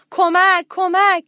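high, loud panicked yell; shouted cry for help. A narrator's reading. Little mouse under the table, shouting for help. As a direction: shout for rescue in alarm. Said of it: "داد زد".

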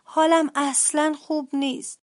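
put-on high, strained sick-child voice; quiet, raspy, forced. A narrator's reading. Sick sister bear answering mother, raspy forced little voice. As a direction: squeeze the words out weakly. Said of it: "با صدای آروم و خشدار به زور گفت".

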